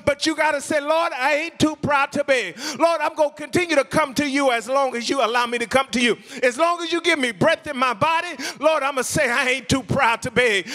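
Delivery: impassioned pleading, high-pitched and insistent; fervent preaching. Acted. Preacher voicing a struggling believer's plea to God, fervent preaching. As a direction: pile up each plea in a driving preacher's cadence.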